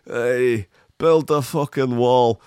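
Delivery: a hint of mock Scottish; lightly put-on Scottish accent; bad Scottish accent. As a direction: toss it out in a rough Scottish impression. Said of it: "That accent is so bad".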